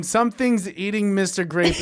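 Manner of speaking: loud, high offended repeat; mock outrage at the title; indignant. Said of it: "most offensive recollection".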